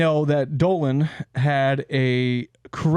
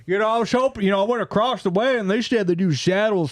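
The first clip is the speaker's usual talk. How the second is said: higher-pitched mock cowboy customer; 6 semitones higher than usual. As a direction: play a bargain-hunting cowboy. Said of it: "cowboy comes in".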